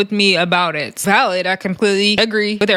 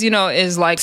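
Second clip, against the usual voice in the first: ordinary talk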